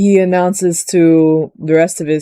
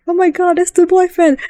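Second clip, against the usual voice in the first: mock fangirl scream; 12 semitones higher than usual. Fan seeing the boyfriend on screen, mock screaming. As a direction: squeal in put-on fan delight. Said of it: "I am also screaming".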